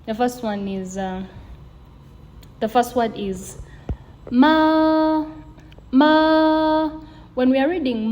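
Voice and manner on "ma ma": "ma" held long on one high, level note; flat tone. Shown as a demonstration. Teacher showing learners the first tone, flat tone. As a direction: sustain "ma" high and loud without moving the pitch. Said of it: "Being a flat tone, we have to sustain".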